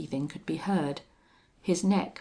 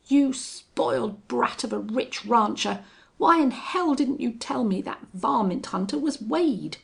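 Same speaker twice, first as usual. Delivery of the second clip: loud, higher furious accusation; cursing anger; 6 semitones higher, 5 dB louder than usual. A narrator's reading. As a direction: tear into him in rage. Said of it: "He cursed horribly".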